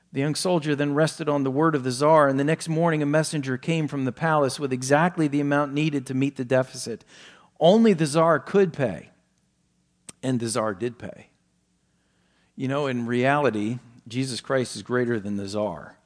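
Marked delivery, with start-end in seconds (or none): 0.1-11.3 preacher telling a story, rising and louder; story narration. Acted. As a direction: tell the tale with growing drama. Sermon illustration story read.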